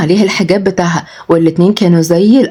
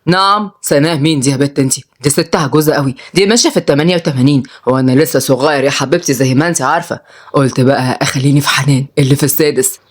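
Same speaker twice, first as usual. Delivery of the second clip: put-on lower-pitched old grandad, carefree and teasing; low grandfatherly banter; wry grandfather; 4 semitones lower than usual. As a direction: play a cheeky grandpa in a low voice.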